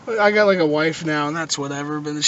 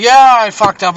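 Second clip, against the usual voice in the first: loud, higher mock admission; mock confession; 4 semitones higher, 10 dB louder than usual. Caller owning up to roommate about phone bill, mock loud. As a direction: blurt it out loudly, play-acting.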